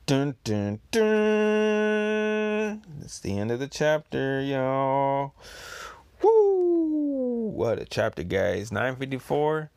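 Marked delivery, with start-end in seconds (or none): 0.1-2.8 dramatic sting. Acted. Dramatic sting at chapter end. 6.2-7.5 woo. Real, not acted. Cheering at end of chapter.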